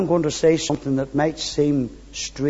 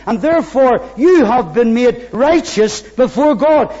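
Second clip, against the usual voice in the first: full-force preaching; 10 semitones higher, 7 dB louder than usual. Acted. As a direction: build into ringing proclamation.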